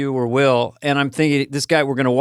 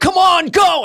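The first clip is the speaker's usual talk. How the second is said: much higher, louder hurry-up; urgent call; 14 semitones higher, 4 dB louder, slower than usual. Retold or imitated. Producer rushing him through the mob, loud mock urging.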